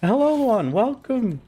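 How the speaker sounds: high bubbly hello; high excited greeting. Real, not acted. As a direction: greet a friend brightly.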